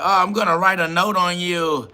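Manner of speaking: hammed-up tattletale taunt; mock tattletale voice. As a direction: parody a petty snitch. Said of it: "They just like".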